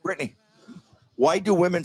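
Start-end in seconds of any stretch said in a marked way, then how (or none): 0.0-0.4 high call across the room. Real, not acted. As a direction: call her over, pitched up. Calling out to colleague.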